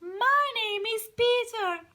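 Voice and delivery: high squeaky little bird voice. Acted. Teacher voicing Peter the little bird, high and light. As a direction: speak up high as a tiny bird.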